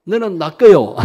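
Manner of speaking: loud, playful Jeolla accent; Jeolla dialect. Shown as a demonstration. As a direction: try out a Jeolla version for the congregation. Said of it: "전라도 버전은요".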